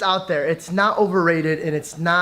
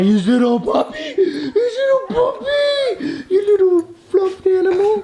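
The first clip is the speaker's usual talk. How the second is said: very high squealing cooing voice; gushing, high-pitched pet voice; 13 semitones higher, slower than usual. Real, not acted. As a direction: coo and squeal over a cute puppy. Cooing at a puppy.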